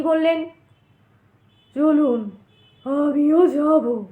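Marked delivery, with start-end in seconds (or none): 1.7-4.0 slow, drawn-out, hollow-sounding reply; the goat-faced stranger tells the narrator he will come too, slow and eerie. A narrator's reading. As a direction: let the words crawl out like a ghost's.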